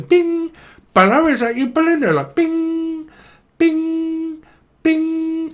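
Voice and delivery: high-pitched comic ringing and hello; mock phone call. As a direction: ring like a phone, squeaky caller voice. Imitates phone ringing and caller.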